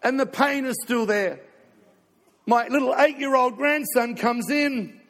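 fervent, high-pitched proclamation; impassioned preaching. Real, not acted. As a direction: ring it out loudly to the congregation. Preacher building to forceful declaration.

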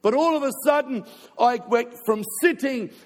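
fervent, high-pitched proclamation; impassioned preaching. Real, not acted. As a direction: ring it out loudly to the congregation. Preacher building to forceful declaration.

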